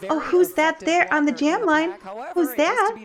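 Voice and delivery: high, delighted who's-that; excited surprise. Real, not acted. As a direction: gush with sudden delight. Spotting young jammers on line.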